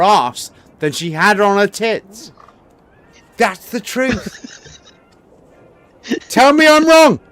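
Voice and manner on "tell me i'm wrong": loud challenge. Real, not acted. Challenging the stream audience, calling out.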